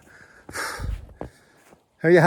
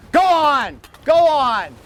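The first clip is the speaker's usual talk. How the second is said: loud shout; 11 semitones higher, 6 dB louder, much slower than usual. Shown as a demonstration. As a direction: shout to scare off an animal. Showing what people shout.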